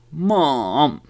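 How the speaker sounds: put-on a child protesting to mom; high, swooping tantrum whine. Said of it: "like a down and up".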